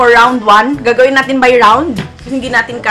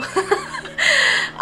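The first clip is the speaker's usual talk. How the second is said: giggle; 6 dB quieter than usual. Real, not acted. Laughing at brother.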